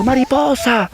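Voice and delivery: high, stretched-out calling; distant cry for help. Acted. Little worm calls the butterfly to rescue him, high cry. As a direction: call out as the endangered worm. Butterfly hears worm's call.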